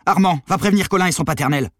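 high, loud, furious shouting; yelled threat. A narrator's reading. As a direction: keep yelling threats and orders at gunpoint. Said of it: "hurla Maddy". (menaces et ordres hurlés)

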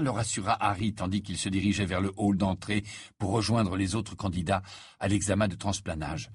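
plain reading voice

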